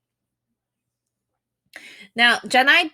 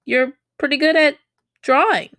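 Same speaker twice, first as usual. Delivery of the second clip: higher, a bit louder, impressed and curious; pleasantly surprised; 7 semitones higher, 4 dB louder than usual. Shown as a demonstration. Teacher voices a friend admiring a drawing, pleasantly surprised. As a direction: react as if you just spotted their talent. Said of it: "but more of".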